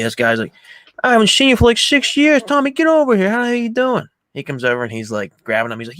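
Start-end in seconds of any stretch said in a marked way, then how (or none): 1.0-4.0 high, loud mock welcome; imitated boisterous greeting. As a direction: hail a long-lost pal, hammed-up. Retold loud reunion greeting.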